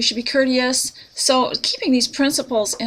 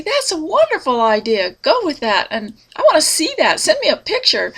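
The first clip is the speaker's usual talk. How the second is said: higher-pitched, delighted reaction to an idea; enthusiastic encouragement; 6 semitones higher than usual. Acted. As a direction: cheer on a friend's idea, pitch lifted. Acts out reacting to shared idea.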